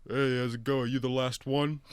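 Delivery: light, punchy mock dialogue; retold movie character. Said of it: "They sound exactly the same".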